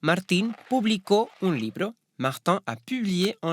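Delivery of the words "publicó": publicó with the stress on CÓ; stress on last syllable. Shown as a demonstration. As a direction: say publicó as pu-bli-CÓ, final syllable strongest. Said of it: "avec accentuation sur le O". (publicó accentué sur la dernière syllabe)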